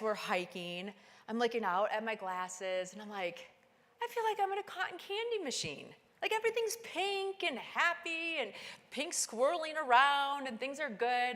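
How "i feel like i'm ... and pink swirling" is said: much higher, floating with delight; dreamy delight. Retold or imitated. Climber daydreaming through pink goggles, giddy and high. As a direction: drift off in giddy wonder.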